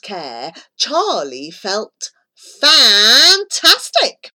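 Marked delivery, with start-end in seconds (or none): 2.6-4.3 relieved delight. A narrator's reading. Relief after wee.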